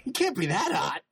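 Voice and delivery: startled, laughing disbelief; surprised. Retold or imitated. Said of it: "I was like".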